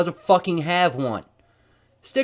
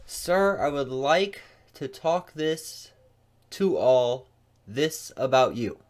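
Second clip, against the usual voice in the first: slow, baffled imitation; slow mock repeat; much slower than usual. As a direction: drag each garbled word out slowly. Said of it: "What the fuck language is that?".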